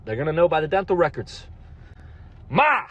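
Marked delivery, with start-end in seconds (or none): 2.5-2.9 high, sudden call to mother; sharp high-pitched summons. Acted. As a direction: yell for your mom. Calling out to mother.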